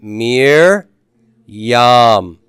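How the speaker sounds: "Mir" loud and drawn out, pause, then loud "yam"; syllable-by-syllable pronunciation. Shown as a demonstration. Teacher asking class to say that, syllable by syllable. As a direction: lead the repeat: MIR... YAM, long vowels. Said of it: "Say that".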